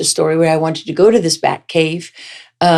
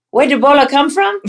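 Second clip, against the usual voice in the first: high, louder incredulous Liberian pidgin question; mock Liberian question; 10 semitones higher, 4 dB louder than usual. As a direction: echo the grave digger, pitched up in disbelief. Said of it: "I'm like".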